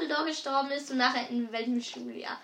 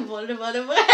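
laughing; 3 semitones lower, 4 dB louder, much faster than usual. Real, not acted. Laughing answer.

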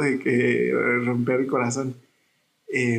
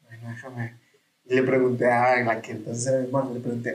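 ordinary talk

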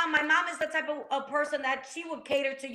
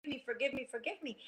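soft, understated retelling of a tearful plea; tearful pleading; 9 dB quieter than usual. Retold or imitated. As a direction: plead quietly, fading softer with each repeat. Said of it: "I was crying".

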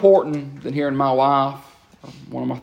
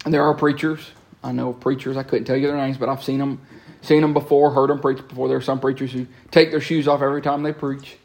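ordinary talk

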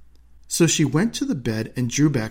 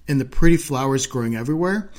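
ordinary delivery, nothing marked

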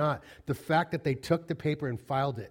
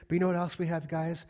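usual speaking voice

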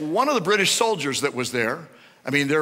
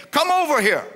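put-on German accent; 9 semitones higher, 4 dB louder than usual. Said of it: "this thick German accent".

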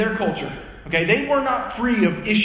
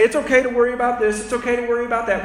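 fast mock caveat list; 2 semitones higher, much faster than usual.

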